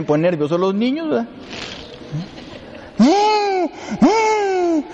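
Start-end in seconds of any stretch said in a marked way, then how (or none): 3.0-4.8 put-on high, whiny baby wail; baby crying imitation. Priest imitating the crying baby on the plane, high whine. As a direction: mimic a fussing infant's cry.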